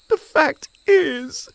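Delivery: very high, loud, slow voice; airy excuse, drawn out; grasshopper excuse. Acted. Grasshopper explaining to the ants, high slow airy.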